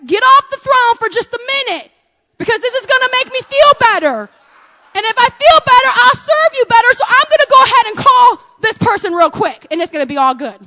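high, loud, pushy brush-off of God; bossy dismissal. Retold or imitated. Preacher voicing her own pushy self telling God to move. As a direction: shoo God off the throne, impatient. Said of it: "And I said".

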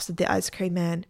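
ordinary delivery

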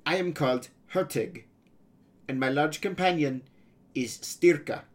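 put-on high, accented boy pirate introducing himself.

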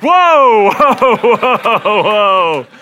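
loud, high, laughing panic at the knife; alarmed whoa. Retold or imitated. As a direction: back off fast, half laughing in alarm. Guide pulls out a knife.

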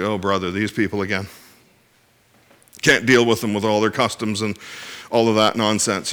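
low, soft mock grumbling; acted weary grumble. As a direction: grumble under your breath, as if fed up.

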